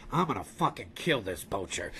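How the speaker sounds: very deep, hushed elephant threat; Jumbo. Acted. Elephant plots against the poacher, deep quiet menace. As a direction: drop to the bottom, quiet and menacing. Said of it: "I imagine that's how he sounds".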